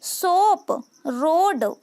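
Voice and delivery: सोप, रोड with a held "oh"; slow phonics examples, "sooop, roood"; long O sound. Shown as a demonstration. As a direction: stretch the vowel in सोप and रोड. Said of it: "ओ का sound होगा यहाँ पर".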